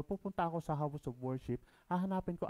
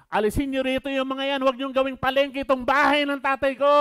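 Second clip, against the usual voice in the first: loud, higher furious command; angry shout; 10 semitones higher, 13 dB louder than usual. Acted. Jesus driving out sellers, loud raging shout. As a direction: yell them out of the temple. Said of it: "yung aking sigaw".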